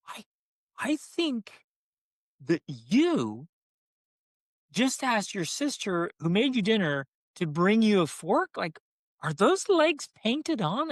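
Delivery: high, mock-incredulous tease; playful teasing. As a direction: tease the kid with big fake disbelief. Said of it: "my playfulness".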